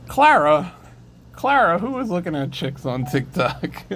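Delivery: high loud teasing call; calling Clara. Real, not acted. Teasing Clara about TikTok, loud high call. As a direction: call across the room, teasing.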